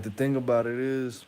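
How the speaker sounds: ordinary talk, nothing marked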